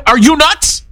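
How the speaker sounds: "Are you nuts?" with a rising, harsh disbelief; harsher rhetorical question. Shown as a demonstration. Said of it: "a lot harsher saying".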